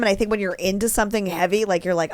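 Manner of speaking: usual speaking voice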